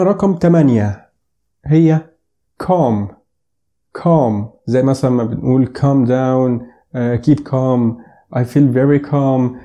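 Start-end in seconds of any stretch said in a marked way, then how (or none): 2.6-9.6 calm said "kahm", silent l, very slow; calm drawn out, no l; correct English pronunciation. Shown as a demonstration. Teacher slowly modelling calm in examples. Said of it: "مش calm".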